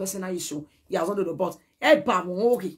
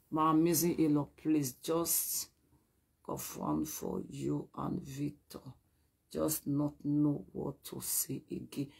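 slow, much softer, drawn-out plea; soft pleading; 5 semitones lower, 9 dB quieter, slower than usual. Retold or imitated. Voicing the in-law's message, a soft slow plea. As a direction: let it fade into a small begging voice.

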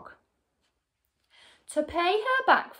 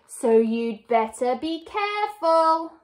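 higher, drawn-out gloating threat; cackled warning; 5 semitones higher, 4 dB louder than usual. A narrator's reading. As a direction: crow the warning like a cackling villainess. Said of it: "cackled Missus Twit".

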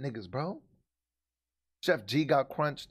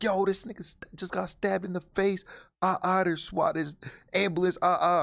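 frantic retelling; 6 semitones higher than usual. Retold or imitated. Friend's frantic report, acted.

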